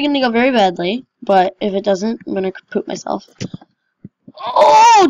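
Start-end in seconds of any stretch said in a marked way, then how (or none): 4.4-5.1 sudden high yelp of surprise; startled high oh; surprised oh. Real, not acted. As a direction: blurt it out, shocked. Surprised it worked.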